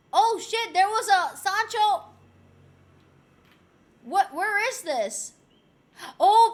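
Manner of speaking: usual speaking voice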